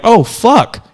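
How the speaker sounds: sudden oh-no moment; dismayed realization. Retold or imitated. A comic imitates a first-timer facing an hour set, mock dismay. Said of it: "You're gonna be like".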